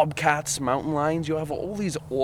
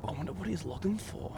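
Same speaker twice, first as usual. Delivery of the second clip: quiet; 7 semitones higher, 9 dB quieter than usual. Real, not acted. Quiet at night near wildlife.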